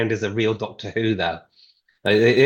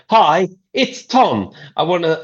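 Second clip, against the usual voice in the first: slow, higher, louder caller greeting; drawn-out, raised-voice phone hello; mock phone greeting; 5 semitones higher, 5 dB louder than usual. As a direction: announce yourself slowly down the line. Imitating himself making a phone call.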